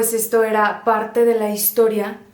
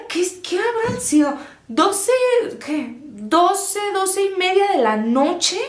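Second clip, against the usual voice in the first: excited; 8 semitones higher, 3 dB louder than usual. Real, not acted. Recalling eerie birdsong.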